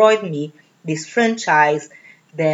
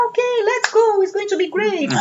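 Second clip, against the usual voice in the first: much higher, eager voice of her younger self; young naive self; 14 semitones higher than usual. Retold or imitated. Answering husband's offer to move, eager high-pitched naive voice. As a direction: play the naive young wife saying yes. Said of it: "And I was young and naive and said".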